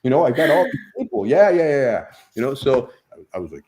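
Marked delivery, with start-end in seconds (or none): 0.0-2.1 mock bragging. Mock boast of an entourage.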